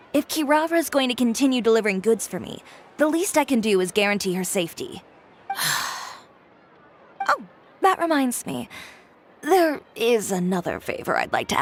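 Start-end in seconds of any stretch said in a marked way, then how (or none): 5.5-6.1 tired sigh. Acted. Chiori tired of trouble.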